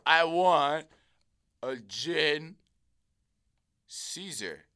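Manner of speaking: lightly voiced drunk, halting between words; plastered customer. Drunk customer repeating his order to the bartender, halting drunk delivery. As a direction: imitate a drunk ordering with long pauses, loud start dropping away. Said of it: "these guys just plastered".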